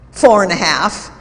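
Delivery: indignant. Retold or imitated. Employee snaps out the time.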